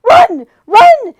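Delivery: high loud urgent shout; urgent shouted warning; crying out. A narrator's reading. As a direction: yell a warning. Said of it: "it shouted again".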